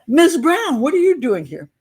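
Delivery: high, loud surprised call across a bar; a man in a bar shouts in surprise on seeing her. Retold or imitated. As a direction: yell it out in surprise. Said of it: "somebody yelled out".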